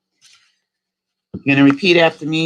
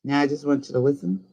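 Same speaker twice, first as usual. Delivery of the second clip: ordinary talk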